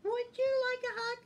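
high-pitched cuddly bear voice. A narrator's reading. Bear offers Skunk a hug, high-pitched. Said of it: "said Bear".

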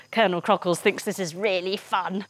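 usual speaking voice

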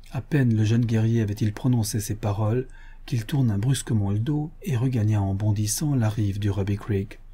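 usual speaking voice

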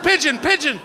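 high, loud exasperated shouting; frustrated yelling. Retold or imitated. Would-be falconer yelling at a bird that won't land. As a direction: yell at the sky for the bird to land. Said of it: "I was stood there for hours going".

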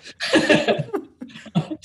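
sudden airy burst of laughter high up; real amused laugh; breathy high laugh. Real, not acted. Laughing at the free meal.

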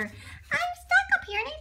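high small bear voice. Acted. Baby bear stuck in the tree, high whine. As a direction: whimper like a stuck little cub.

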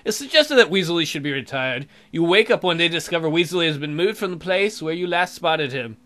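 normal talking voice

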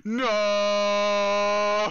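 long, loud, high drawn-out "no"; anguished scream. Real, not acted. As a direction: wail it out in sustained dismay. Dismay at game setback.

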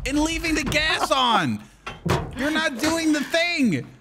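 high, slow exasperated whine. Real, not acted. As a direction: complain up high at your teammate. Scolding friend in submarine.

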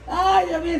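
usual speaking voice

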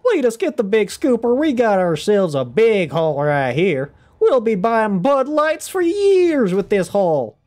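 high, loud, slow Southern drawl; Southern good old boy bragging over a big haul; Southern accent. Acted. Streamer shows off her favorite Southern accent, high and loud. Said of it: "Southern is by far still one of my favorites to do".

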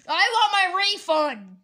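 high, very loud, slow yell; angry demand. Pretend. Customer insisting on a refund from the seller, angry yell. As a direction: bellow each word as a furious pretend customer.

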